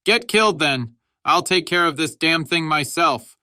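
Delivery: high, loud, fed-up yell; raging at a useless comrade; angry shout. A narrator's reading. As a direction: shout it in furious disgust. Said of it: "shouted Halls".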